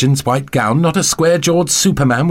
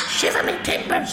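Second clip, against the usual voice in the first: thin, harsh shriek with no clear pitch; shrieking creature; 7 dB quieter than usual. A narrator's reading. Piro startled by the shape by the television, shrieking. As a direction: screech it out like a startled pet. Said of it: "Piro shrieked".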